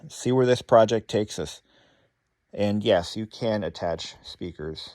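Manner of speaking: ordinary talk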